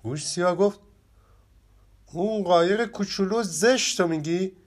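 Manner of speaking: lively children's story reading, characters lightly voiced; expressive storybook narration with playful character voices. A narrator's reading. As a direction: tell it like a bedtime tale for kids. Reads the story aloud.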